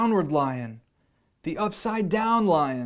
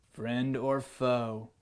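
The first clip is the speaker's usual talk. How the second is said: slow, lowered lion; the lion quizzes the boy slowly and low; 4 semitones lower, 4 dB quieter, slower than usual. Acted. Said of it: "said the lion".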